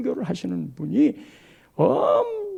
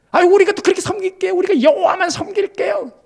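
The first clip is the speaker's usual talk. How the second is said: fast, much higher, hurried promises; eager pleading people; 10 semitones higher, 6 dB louder, faster than usual. Retold or imitated. The people hurriedly assure Joshua, high and fast. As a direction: gush promises to please him.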